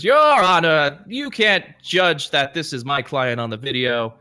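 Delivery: high, loud, slow protest; indignant outburst. Acted. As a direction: demand it, outraged. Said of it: "He says".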